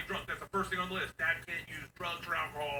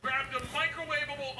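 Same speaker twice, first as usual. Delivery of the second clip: exasperated; 10 semitones higher than usual. Retold or imitated. Dad venting about daughter's lunch, exasperated. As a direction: spit out the insult.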